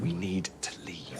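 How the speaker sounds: hushed, urgent fear; frightened whisper while the robber waits in the queue; scared whisper. Real, not acted. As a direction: whisper it in alarm.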